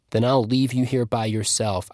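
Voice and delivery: plain reading voice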